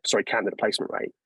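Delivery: ordinary talk, nothing marked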